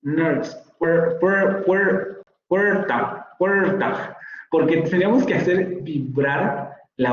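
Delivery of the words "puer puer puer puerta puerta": puerta tried with an r-coloured vowel, higher and halting; attempted rhotic vowel. Shown as a demonstration. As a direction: struggle to make the vowel of puerta vibrate. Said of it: "ni siquiera lo puedo pronunciar en español". (intento de puerta con vocal rótica, titubeando)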